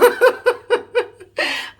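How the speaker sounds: a high, loud burst of laughter. Real, not acted. Vlogger laughs loudly after saying she is emotional. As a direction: break into a big laugh.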